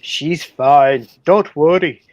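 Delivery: put-on loud Scottish-accented husband; loud Scottish burr to his sick wife. A narrator's reading. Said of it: "you're doing a Scottish accent?".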